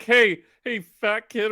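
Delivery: high, loud mock heckle; imitated schoolkid shout; jeering call. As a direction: call out like a kid at the back of a bus. Imitating kid yelling from bus.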